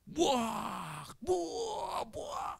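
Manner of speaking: long high whooshing wave noises; drawn-out mimicked wave roar; imitated crashing waves. Shown as a demonstration. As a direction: voice the sea crashing over the boat.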